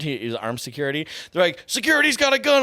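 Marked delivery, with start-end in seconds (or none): 1.7-2.6 high-pitched mock alert; retold alarm call. Agents spot the armed security guard, high retold alarm. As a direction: act out agents urgently flagging a weapon.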